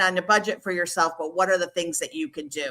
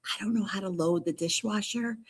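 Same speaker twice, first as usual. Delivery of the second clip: hushed, soft stage whisper; confiding whisper; 7 dB quieter than usual. Pretend. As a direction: whisper it like an embarrassing secret. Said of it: "well, I'm gonna whisper to you".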